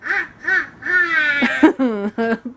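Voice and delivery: cackling villain laugh; evil chuckle. Pretend. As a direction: laugh like a cartoon baddie. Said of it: "Can I hear your best villain laugh?".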